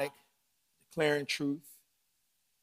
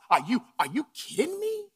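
incredulous; 9 semitones higher, 5 dB louder than usual. Acted. Incredulous rhetorical outburst.

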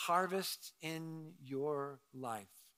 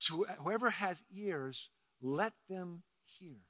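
softer, hushed "To whoever has ears, let them hear"; quiet reflective repeat; 7 dB quieter than usual. Shown as a demonstration. Preacher repeating the verse to the congregation, hushed. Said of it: "repeats the phrase quietly".

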